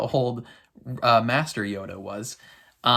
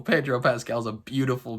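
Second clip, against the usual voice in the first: ordinary talk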